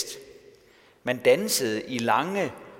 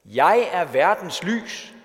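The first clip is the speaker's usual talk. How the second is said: loud, higher, slow proclamation; proclaiming shout; 4 semitones higher, 6 dB louder, slower than usual. Retold or imitated. Preacher quoting Jesus calling out amid the festival, proclaiming shout. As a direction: call it out slowly to a whole crowd. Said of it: "stillede sig op og råbte".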